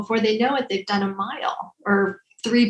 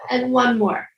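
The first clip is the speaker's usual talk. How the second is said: guided breathing instructions; 3 semitones higher than usual. Acted. Leads breathing exercise.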